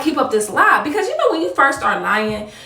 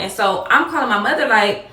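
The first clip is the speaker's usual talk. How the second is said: normal talking voice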